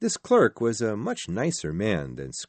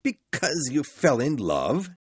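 higher, incredulous echo from Scrooge; shouted disbelief; 6 semitones higher, 3 dB louder than usual. A narrator's reading. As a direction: throw it back in disbelief. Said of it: "shouted Scrooge".

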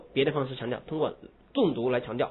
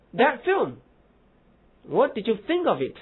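That film raised and set apart; That film fronted; 8 semitones higher, slower than usual. Shown as a demonstration. Teacher showing fronting for emphasis. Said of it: "那么把that film放在前面".

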